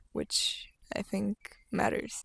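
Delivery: hushed aside, almost under the breath; whispered aside. Real, not acted. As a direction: drop to a quick whisper for yourself. Whispered aside about crying.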